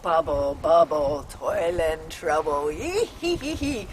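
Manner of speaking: hammed-up witch spell and cackle. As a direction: chant like a cauldron witch. Witch chant at the cauldrons.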